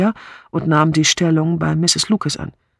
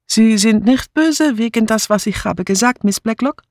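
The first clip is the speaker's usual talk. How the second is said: high-pitched, pleading maid's voice; meek foreign maid; 6 semitones higher than usual. A narrator's reading. Maid asking her mistress if she is cross, meek and high. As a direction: ask timidly, pitched well up.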